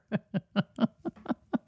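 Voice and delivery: soft trailing laugh; breathy giggle dying away. Real, not acted. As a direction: let the laughter run on quietly. Laughs at her own remark.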